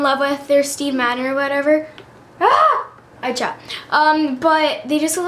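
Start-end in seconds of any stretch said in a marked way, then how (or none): 2.4-2.9 high startled shriek; sudden yelp. Real, not acted. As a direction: yelp out in surprise.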